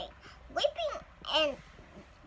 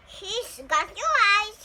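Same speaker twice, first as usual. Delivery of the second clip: slow, higher proud remark; proud papa; 5 semitones higher, 6 dB louder, slower than usual. Acted. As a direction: savor it like a proud father. Papa tells mama proudly.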